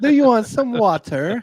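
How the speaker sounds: high, loud mock offer, WATER with an over-crisp T; mock hard T. Co-host teasing friends about "water", high mock hard T.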